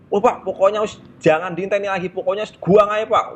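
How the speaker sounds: high-pitched egging on; goading assistant. Retold or imitated. Assistant urging the conductor to dump the students, high goading. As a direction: push the conductor to throw them off. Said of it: "Manas-manasin".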